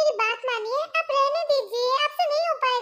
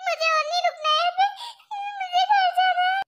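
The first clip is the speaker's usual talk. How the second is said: panicked crying; 5 semitones higher than usual. Acted. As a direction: bawl like a child after a scary ride. Panicked boss wails after the ride.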